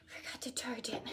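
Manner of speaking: real whisper, tossed off to herself; whispered aside. Real, not acted. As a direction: whisper it like a small confession. Aside about dead phone.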